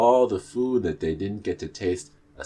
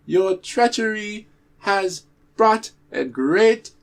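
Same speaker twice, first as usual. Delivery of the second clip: stern bird king; 13 semitones higher, 7 dB louder than usual. Acted. Bird king banishing the tortoise, high and thundering. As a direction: pronounce banishment from the throne. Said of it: "the bird king spoke up and said".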